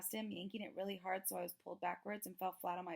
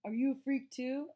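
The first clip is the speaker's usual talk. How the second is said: 1 mocking the bully; 5 semitones higher, 8 dB louder than usual. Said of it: "He was like".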